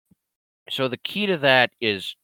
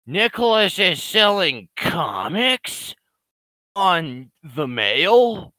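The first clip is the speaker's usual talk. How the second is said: mock disbelief; 8 semitones higher, 6 dB louder, much slower than usual. Mock disbelief at troll news.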